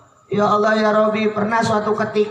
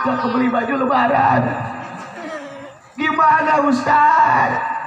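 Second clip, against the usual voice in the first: higher, strained worker pleading; pleading laborer; 6 semitones higher than usual. Acted. Laborer begs the Ustaz about fasting while working, higher and pleading. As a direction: beg the Ustaz like a tired laborer. Preacher voicing a laborer asking.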